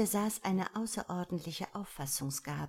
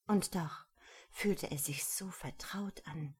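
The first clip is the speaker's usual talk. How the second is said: usual speaking voice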